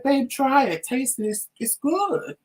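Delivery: wife retelling how she urged husband to taste, sweet and high; sweet coaxing. Retold or imitated. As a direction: coax him like a sweet wife. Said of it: "I sat there and said".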